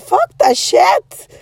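high, loud, drawn-out swearing; retold angry outburst. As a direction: burst out in acted outrage. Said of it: "I was so angry".

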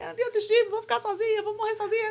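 high, wailing fake cry; mock sobbing. Heartbroken sister on a livestream to brother, mock sobbing. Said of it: "E eu chorando, chorando, chorando".

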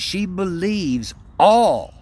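"all" drawn out long, loud and high; "all" stretched with a big swooping emphasis; stress on "all". Retold or imitated. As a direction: lean hard and slowly on "all". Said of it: "emphasized all".

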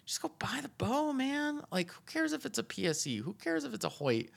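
high, insistent pleading; exasperated urging. Real, not acted. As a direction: beg them to just do it. Urging new bow hunters.

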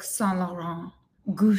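fancy put-on brand accent; Kris Jenner. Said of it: "Kris Jenner changes her accent for every brand".

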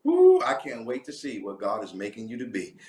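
ordinary talk

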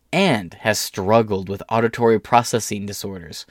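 ordinary talk, nothing marked

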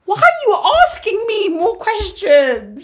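high, loud, drawn-out mock wail; hammed-up slow cry of exasperation; exasperated outburst.

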